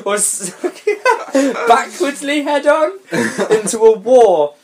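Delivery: giggling through the words; laughing through reading. Real, not acted. Reading the silly book aloud, laughing. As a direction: read it while cracking up.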